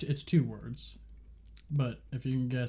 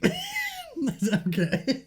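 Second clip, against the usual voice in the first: laughing; 3 dB louder, much slower than usual. Real, not acted. Laughing at friend's reaction.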